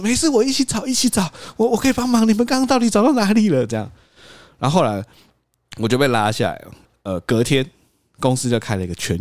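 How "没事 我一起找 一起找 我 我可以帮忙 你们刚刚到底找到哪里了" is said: high, squeaky smoothing-over voice; placating boss. Retold or imitated. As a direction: act the boss hastily calming everyone down. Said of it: "说".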